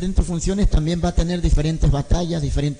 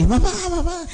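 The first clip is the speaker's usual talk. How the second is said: put-on high, excited child calling his mom; happy child; 13 semitones higher than usual. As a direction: call out much higher, like an overjoyed little boy. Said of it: "alegre, usted sabe". (niño alegre llamando a su mamá con voz muy aguda)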